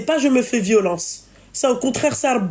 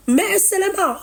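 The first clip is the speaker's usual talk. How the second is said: laughing; 7 semitones higher than usual. Real, not acted. Mocking laugh at the caller.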